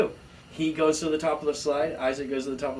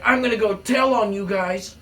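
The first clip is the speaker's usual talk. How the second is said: tattletale threat; 8 semitones higher, 8 dB louder than usual. Retold or imitated.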